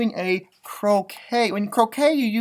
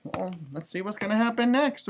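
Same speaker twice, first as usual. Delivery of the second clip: usual speaking voice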